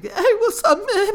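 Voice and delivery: loud, very high overeager hello; mock friendly greeting. Guy reaches out for a handshake, mock-friendly high voice. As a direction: greet them in a squeaky, loud voice.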